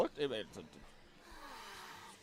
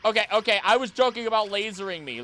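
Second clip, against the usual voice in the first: loud, high, hurried pleading; panicked pleading; 10 semitones higher, 8 dB louder, faster than usual. Real, not acted. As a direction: beg it to stop, alarmed. Pleading with attacking Pokemon.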